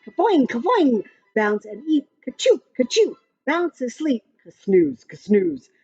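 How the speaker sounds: usual speaking voice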